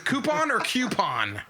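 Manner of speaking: koo-pon, then kyoo-pon, slow and high; two pronunciations. Shown as a demonstration. Host quizzing guests on coupon, both pronunciations set side by side. Asking which way to say it.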